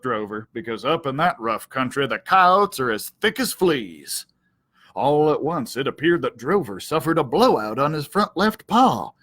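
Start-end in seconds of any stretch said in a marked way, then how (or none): none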